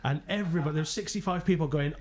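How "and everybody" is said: normal talking voice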